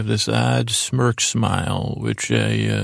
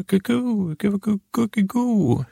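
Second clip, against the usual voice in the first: put-on much higher, cooing like a baby; imitating baby coo; 10 semitones higher than usual. Boss imitates baby Valentina cooing, in a high cooing baby voice. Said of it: "that wasn't a very good baby".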